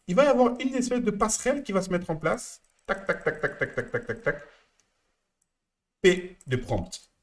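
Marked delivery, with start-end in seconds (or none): 2.8-4.4 even, staccato string of tacs, stepping down in pitch; rapid tac-tac sound. Shown as a demonstration. Coach sketching a bridge being put in place, ticking tacs. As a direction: tick off each tac softly and evenly, descending. Mimics steps falling into place.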